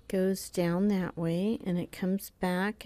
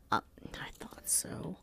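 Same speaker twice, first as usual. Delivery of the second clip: soft unvoiced whisper; 6 semitones lower, 14 dB quieter than usual. Real, not acted. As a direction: breathe it to yourself. Whispered aside.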